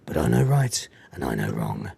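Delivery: low, soft imitation of a stoic hero; Nicholas Angel. Narrator voices Nicholas answering the Reverend, low solemn put-on voice. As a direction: answer low and quiet, in mock gravity. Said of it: "he says".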